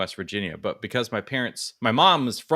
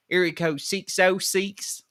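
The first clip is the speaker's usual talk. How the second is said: put-on hillbilly accent; 6 semitones higher, slower than usual. Customer giving phone number at the store, hillbilly accent. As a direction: draw out each digit in hillbilly accent. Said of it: "the redneck hillbilly accent".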